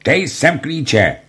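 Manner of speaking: high, drawn-out roar of a demand; roared order. A narrator's reading. Armed corporal demanding the storeroom keys, roared order. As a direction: roar it slowly, hammering each word. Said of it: "zařval".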